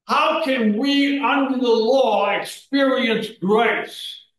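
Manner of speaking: fervent preacher's appeal, pressing each line; preaching at full force. Acted. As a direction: drive it home to the congregation. Preacher raises voice to exhort.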